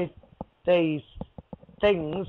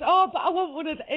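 retold plea in a higher voice; pleading; 10 semitones higher than usual. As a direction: plead for it, pitched up. Pleading for a rabbit in retold dream.

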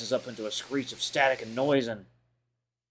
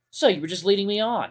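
higher, louder, the voice cracking with hurt; voice breaking; 9 semitones higher, 6 dB louder than usual. Acted. Chase accuses TJ of leading him on, voice breaking louder. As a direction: let the voice crack as it rises. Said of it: "I feel my voice break as it rises in volume".

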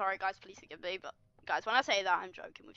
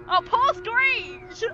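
high, drawn-out mock sympathy; mock pity; 10 semitones higher, 5 dB louder, much slower than usual. Player pitying the depressed monster Screech, mock sorrowful. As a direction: coo loudly in exaggerated pity.

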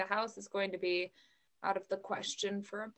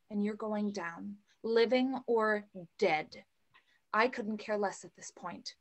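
ordinary talk

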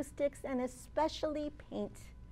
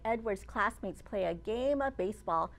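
ordinary delivery, nothing marked

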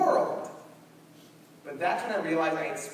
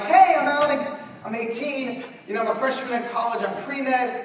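much higher, louder eager voice; eager introduction; 8 semitones higher, 8 dB louder than usual. Retold or imitated. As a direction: sell yourself like an eager freshman. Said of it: "And I'm like".

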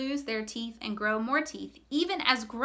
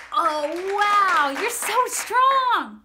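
higher, louder, slow gushing praise; admiring; 6 semitones higher, 7 dB louder, slower than usual. Real, not acted. Host praising the children's muscles, higher and slow. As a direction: gush over their muscles.

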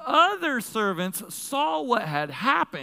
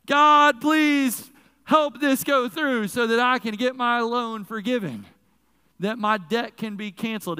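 pleading prayer shout; 4 semitones higher, 7 dB louder than usual. Acted. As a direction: cry out to God for the loan to go through. Mock prayer for loan forgiveness.

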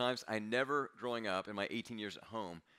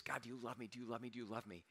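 soft, pleading, much quieter; hushed repeated prayer; 10 dB quieter, faster than usual. Retold or imitated. Pastor retelling years of asking God, hushed. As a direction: murmur the plea over and over.

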